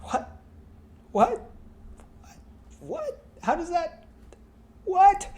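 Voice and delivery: incredulous, one "What?" shooting up very high; baffled what. Real, not acted. Vlogger baffled at the chase scene, rising disbelief. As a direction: keep asking what in disbelief.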